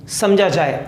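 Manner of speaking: ordinary talk, nothing marked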